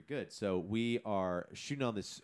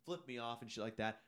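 usual speaking voice

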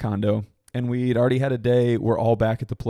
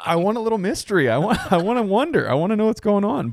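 giddy mock coy; 9 semitones higher, faster than usual. Joking about wanting bikini mystery.

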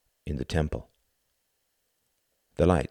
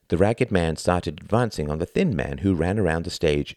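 ordinary delivery, nothing marked

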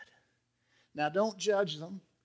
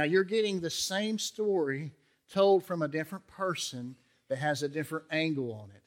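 ordinary delivery

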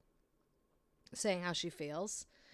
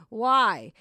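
incredulous why; 12 dB louder than usual. Real, not acted. Repeating her incredulous question.

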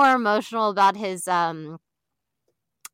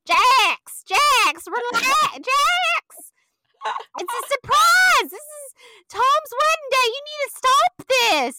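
much higher and louder pleading voice; mock Brittany pleading; 5 dB louder, slower than usual. Brittany begging Jax to relax on wedding day, high pleading. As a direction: beg him to calm down, high and shrill. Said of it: "she's like".

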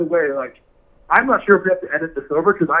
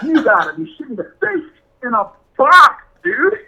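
rising to a loud, high outburst; exasperated outburst; 8 semitones higher, 6 dB louder than usual. Retold or imitated. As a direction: build from disbelief into a yell.

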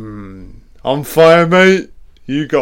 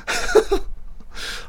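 breathy unvoiced laugh; real amused breathy laugh; 4 dB quieter than usual. Real, not acted. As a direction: laugh under your breath. Laughs at Russian army joke.

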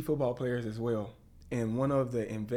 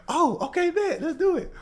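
much higher and louder, bursting with eagerness; eager excitement; 7 dB louder than usual. Retold or imitated. Agreeing to a second take with Michael Irvin, thrilled. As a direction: jump at the chance to do it again. Eager reply, laughing retelling.

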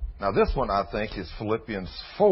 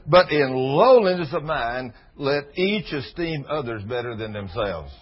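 slow, emphatic reading of scripture; 5 dB louder, slower than usual. Acted. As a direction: read the verse out slowly and heavily. Reads scripture slowly.

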